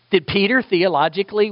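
ordinary delivery

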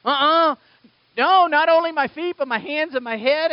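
high, loud, gushing reversal; all in, blurting it out; overeager Peter. Acted. Peter to Jesus, high loud overeager gush. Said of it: "typical foot and mouth man".

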